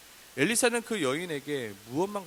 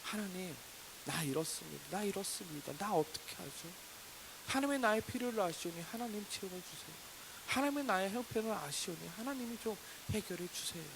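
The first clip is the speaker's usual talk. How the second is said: acted plea, raised pitch without force; pleading prayer; 5 semitones higher, 4 dB quieter than usual. Believer telling God their troubles, pleading. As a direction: lay out your need to God, unforced. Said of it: "내 사정을 말하는 것입니다".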